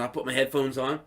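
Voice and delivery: ordinary talk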